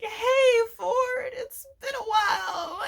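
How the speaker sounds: high, crying-out cartoon greeting; a sly villain squealing hello; Bill's shrill greeting. Bill greets his old enemy Ford in a shrill, strained voice.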